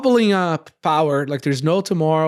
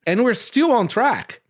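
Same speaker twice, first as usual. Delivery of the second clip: usual speaking voice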